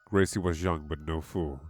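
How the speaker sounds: usual speaking voice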